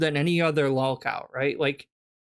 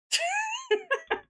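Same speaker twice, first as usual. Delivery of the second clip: shrill squeal of laughter; cackling at the streamer; high shrieking laugh; 5 dB quieter than usual. Real, not acted. Laughs after mocking.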